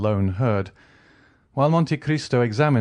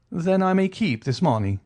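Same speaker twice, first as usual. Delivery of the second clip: lightly voiced polite nobleman; a hint of courtly irony; suave count; 9 semitones higher than usual. A narrator's reading. Count presses.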